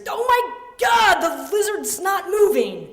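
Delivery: very high, slow panicked yell; horrified shout. Retold or imitated. Brother finding the lizard in its cage, horrified shout. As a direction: cry out in drawn-out horror.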